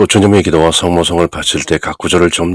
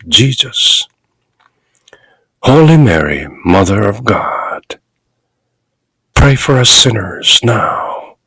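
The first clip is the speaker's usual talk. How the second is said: slow with pauses; much slower than usual. Shown as a demonstration. Priest modelling the Hail Mary for parishioners, slow and paused. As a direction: Hail Mary read very slowly, pausing after each phrase. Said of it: "끊어가면서 읽어주십시오".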